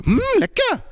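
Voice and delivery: very high, exaggerated "Mm, lekker"; really-good lekker. Shown as a demonstration. Showing students the response to really good food, over-the-top delight. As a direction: push "lekker" right up into squeaky delight. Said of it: "if it's really good, you do this".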